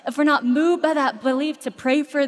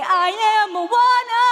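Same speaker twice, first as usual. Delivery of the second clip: proud proclamation; 8 semitones higher, 5 dB louder, slower than usual. Retold or imitated. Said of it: "I say".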